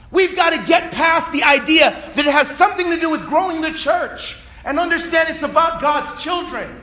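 high, loud preaching that swells into shouts; full-force preaching. Acted. Preacher rallying the congregation to reach everyone, shouting. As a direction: preach it hard, driving the message home.

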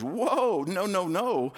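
high startled whoa, then quick no-no-no; shocked refusal. Acted. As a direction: recoil like Peter hearing something outrageous. Peter refuses unclean food.

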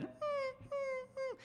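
high, thin whimper imitating a hurt dog. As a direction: whimper like a little dog in pain. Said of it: "she's whimpering".

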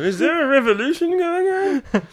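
mock alarm. Friends riffing on a revolution, high and frantic. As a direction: squawk it like a panicked reporter.

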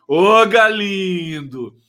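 long, drawn-out loud call to Galindo. Real, not acted. Host hailing a viewer by name, long and loud. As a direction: stretch it out and call across the room.

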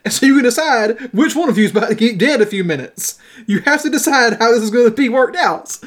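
storyteller acting a forceful speech; calling back. A narrator's reading. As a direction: lay down a threat to the men below. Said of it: "Horton called back".

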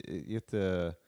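ordinary talk, nothing marked

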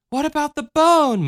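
put-on high childlike chatter; little girl voice. A narrator's reading. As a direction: speak up like a small girl. Michelle asks.

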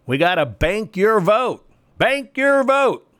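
high, slow mocking sing-song; mocking slogan. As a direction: parody the slogan, rising high on the repeat.

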